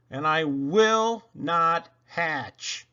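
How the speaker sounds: slow and firm; slow, drawn out. Acted. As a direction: drag out the final refrain. Final refrain slowed down.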